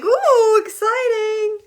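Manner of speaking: high squealing ooh, exciting; excited squeal. Retold or imitated. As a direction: squeal it out, thrilled. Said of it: "I'm like".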